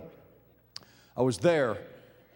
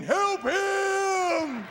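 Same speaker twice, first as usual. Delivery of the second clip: long high-pitched howls; drawn-out mock dog howling; dogs joining in; 11 semitones higher, much slower than usual. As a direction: howl the words like distant dogs. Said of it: "they hear it, they join in".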